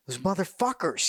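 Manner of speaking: high-pitched mock anger; imitated pissed-off officer. Colonel under incoming rounds getting pissed, mock angry. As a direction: play him getting worked up. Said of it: "he's getting pissed off".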